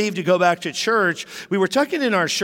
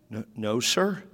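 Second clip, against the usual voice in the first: timid admission; 7 semitones lower, 6 dB quieter than usual. Retold or imitated. Boys caught admitting theft.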